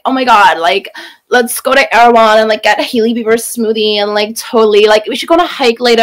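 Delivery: mock Valley Girl American accent; whiny Valley Girl. Shown as a demonstration. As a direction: whine it like a Valley Girl imitation. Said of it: "it's very whiny".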